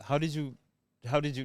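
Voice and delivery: ordinary talk, nothing marked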